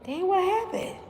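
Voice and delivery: high, airy mock of the guy; imitating a guy who acts surprised; guy's clueless reaction. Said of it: "He was like".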